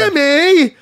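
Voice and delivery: loud, high shout of the name Jimmy; mock Matt Serra yelling Jimmy; Matt's Jimmy call. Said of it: "I like your impersonation of me".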